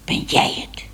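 put-on breathy voiceless hiss of a wary old cat; hissed whisper. A narrator's reading. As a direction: hiss it out suspiciously, no voice. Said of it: "siste ze".

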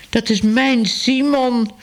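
high, slow indignant cry. A narrator's reading. Mr Smit sees his cat shot at, indignant and high. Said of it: "riep meneer Smit verontwaardigd".

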